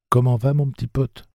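put-on young Jake, gently coaxing; gentle boy. A narrator's reading. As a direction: coax the animal gently as Jake. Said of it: "demanda doucement Jake".

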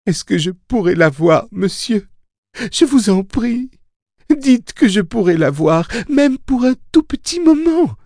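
very high, thin-pitched begging voice; pained pleading. A narrator's reading. Mia begs Sayre to let her see someone, pained pleading. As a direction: beg in a high, hurt voice. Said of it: "submergés de douleur".

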